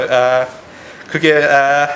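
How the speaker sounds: ordinary talk, nothing marked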